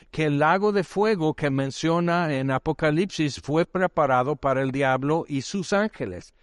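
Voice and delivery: ordinary delivery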